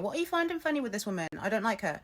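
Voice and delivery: high, rushed indignant grumble; mock-stroppy complaint. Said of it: "I was really stroppy".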